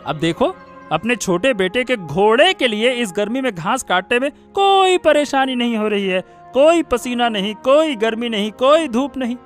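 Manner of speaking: high-pitched, reproachful rant; accusing complaint. Acted. Scolding mother for helping younger son. (शिकायत भरा ताना)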